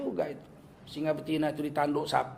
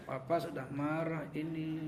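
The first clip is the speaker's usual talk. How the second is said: slow, much softer, held-in anger; quiet angry father; 3 semitones lower, 11 dB quieter than usual. Retold or imitated. Father warning his child he is angry, quiet slow menace. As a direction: say the threat low and slow through closed teeth. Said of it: "malah pelan".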